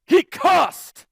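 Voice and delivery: loud, high scandalized cry; mock outrage. Churchgoer tattling to the pastor about the cussing, loud outrage. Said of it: "he was mad because that guy cussed".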